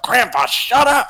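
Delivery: put-on high, whiny child; kid voice. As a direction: play the embarrassed kid. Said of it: "as a kid, I was like".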